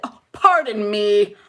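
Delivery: slow, drawn-out overly polite apology; mock-proper pardon. Mocking a woman too formal among girlfriends, mock-proper. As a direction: put on exaggerated old-fashioned manners. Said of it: "Like, nobody says that".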